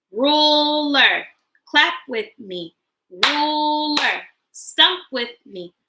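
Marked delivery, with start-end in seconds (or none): 0.1-1.3 "Ruler" in two beats, the first held long; split into syllables. Shown as a demonstration. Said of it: "Break words into parts with me". 3.3-4.2 "Ruler" in two beats, the first held long; split into syllables. Shown as a demonstration. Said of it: "Break words into parts with me".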